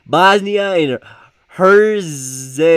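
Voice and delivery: loud, high, sounded-out name; struggling through a difficult name; labored pronunciation. Real, not acted. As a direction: wrestle each syllable out loudly. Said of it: "I've always had difficult".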